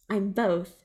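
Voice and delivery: ordinary delivery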